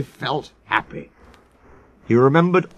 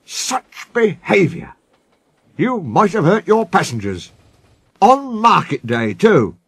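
high, indignant telling-off; cross old engine's reproach; scolding; 6 semitones higher than usual. Acted. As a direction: scold them with emphatic outrage. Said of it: "he scolded".

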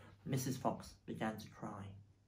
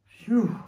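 loud, high exhaled phew; acted gust of relief; relieved phew; 9 semitones higher, 14 dB louder than usual. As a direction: let out a big sigh of relief. Relief after escaping the shovels.

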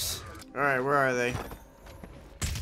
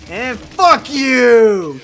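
triumphant yell; 6 semitones higher, 10 dB louder, much slower than usual. Real, not acted. Triple kill taunt.

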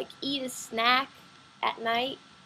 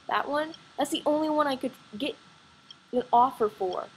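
ordinary talk, nothing marked

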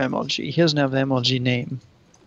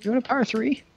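much higher, singsong question; high-pitched offer; 11 semitones higher, faster than usual. Real, not acted. Offering a teammate a power three, high-pitched. Offer misheard, then asked again plainly.

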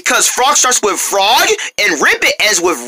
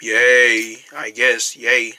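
reluctant cheer; 9 semitones lower, much slower than usual. Acted. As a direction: cheer with no enthusiasm at all. Neighbor told to say yay.

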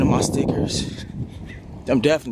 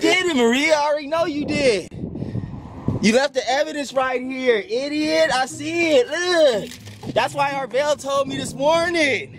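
real furious high-pitched accusation; outraged; 9 semitones higher than usual. Real, not acted. Accuses Maria of the prank.

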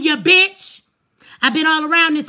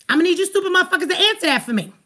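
normal talking voice

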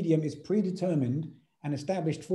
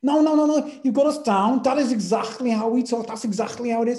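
louder and higher, insisting eagerly; excited protest; 7 semitones higher, 6 dB louder than usual. Retold or imitated. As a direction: gush that he nailed it, loud and high. Danes insisting he captured them.

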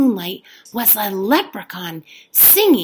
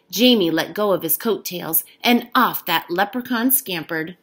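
usual speaking voice

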